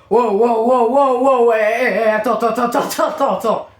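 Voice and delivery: loud, rapid string of wows; loud alarmed "hold on" reaction; startled outburst. Real, not acted. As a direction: cut in loudly, stunned by the screen.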